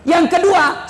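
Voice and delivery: ordinary talk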